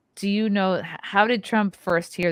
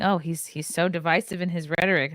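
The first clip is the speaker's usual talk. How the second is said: ordinary talk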